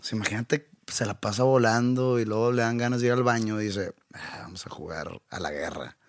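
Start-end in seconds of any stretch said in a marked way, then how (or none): 4.1-6.0 playful conspiratorial whisper. Pretend. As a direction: whisper it like a scheming bird. Voicing the bird's thought.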